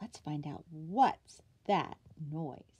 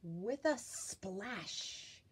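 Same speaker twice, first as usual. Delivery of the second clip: ordinary delivery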